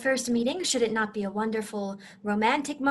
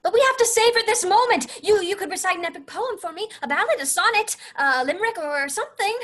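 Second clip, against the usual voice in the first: high, loud, insistent protest; urgent pleading; 6 semitones higher, 4 dB louder than usual. Acted. Fiona begging her knight for a poem, urgently pleading. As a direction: plead excitedly to savor it.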